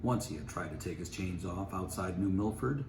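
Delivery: ordinary delivery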